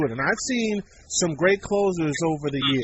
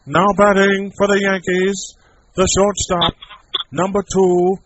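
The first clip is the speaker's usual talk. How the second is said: slow, booming stadium announcer voice; echoing ballpark PA call; Bob Shepard PA announcer; 2 semitones higher, 6 dB louder, slower than usual. Retold or imitated. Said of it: "I did this impression for a friend of mine".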